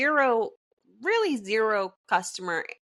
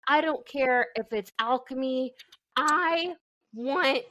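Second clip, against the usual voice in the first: higher-pitched, emphatic mock demand; playful insistence; 4 semitones higher than usual. Friend demanding crab cakes, witchcraft or not, playfully insistent. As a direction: insist stubbornly, landing each refrain the same way.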